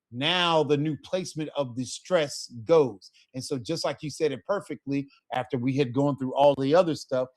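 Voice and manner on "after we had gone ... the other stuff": ordinary talk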